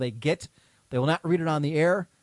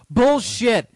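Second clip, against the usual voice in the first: loud laughing callout; 7 semitones higher, 6 dB louder than usual. Real, not acted. Calling out lazy listeners.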